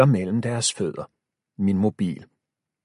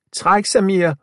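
high loud command; shouting; 10 semitones higher, 7 dB louder than usual. A narrator's reading. Milo shouting at Samir to pull him up. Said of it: "råber jeg".

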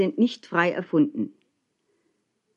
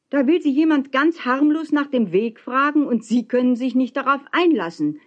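indignant, raised high voice; zurückgeschrien; 6 semitones higher than usual. A narrator's reading. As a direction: call back up at him in protest. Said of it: "schrie ich zurück". (empört zurückrufen)

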